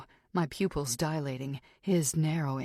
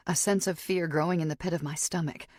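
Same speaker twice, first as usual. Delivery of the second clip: ordinary delivery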